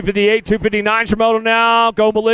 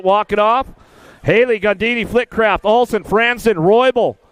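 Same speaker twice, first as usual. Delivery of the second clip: ordinary talk, nothing marked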